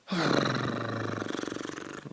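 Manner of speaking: long, drawn-out exhale; long sigh. Real, not acted. Vlogger deciding whether to upload, long sigh.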